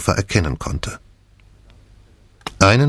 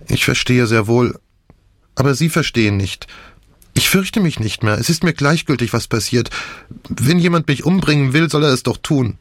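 put-on higher-pitched young patient in distress; Nick, overwrought; 6 semitones higher than usual. A narrator's reading. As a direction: protest with agitated resignation.

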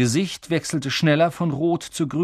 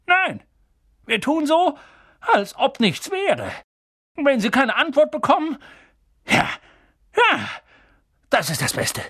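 high, urgent, halting thought; agitated resolve; 12 semitones higher, 3 dB louder than usual. Acted. Uncle deciding to ignore the letter, high and agitated. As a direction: work out the plan nervously as you speak. Uncle forms a panicky plan.

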